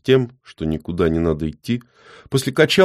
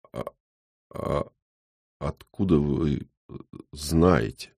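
quiet, faltering, softer voice; weakening voice; 3 semitones lower, 5 dB quieter, slower than usual. Acted. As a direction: voice fading with shock. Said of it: "слабеющим голосом".

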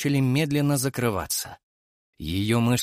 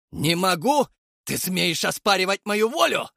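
steely shout; 8 semitones higher than usual. A narrator's reading. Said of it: "стальным тоном прокричал я".

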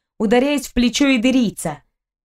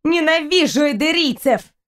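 angry outcry; 6 semitones higher than usual. A narrator's reading. Angry outburst continues.

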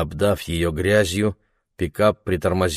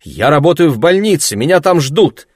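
loud, higher, insistent protest; firm and loud; 7 semitones higher, 8 dB louder than usual. A narrator's reading. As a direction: push back hard and loud. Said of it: "собственный голос звучал жёстче".

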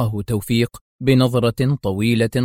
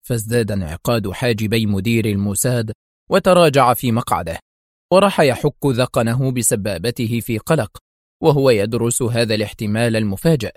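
ordinary delivery, nothing marked

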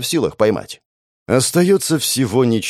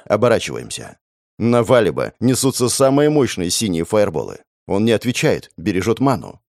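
ordinary delivery

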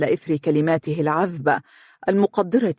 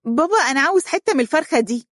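put-on high, quick child's plea; small child interrupting dad, pitched way up; little child's voice; 10 semitones higher, faster than usual. A narrator's reading. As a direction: pipe up like a kid at dinner. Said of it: "قاطعه أحد الأولاد قائلا".

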